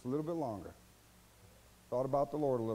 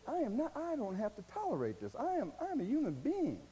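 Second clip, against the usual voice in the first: indignant self-respect; 8 semitones higher, 3 dB quieter than usual. Acted. Finally standing up for yourself.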